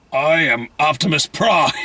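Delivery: low, slow robot leader; lightly voiced Optimus Prime. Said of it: "all I can think of".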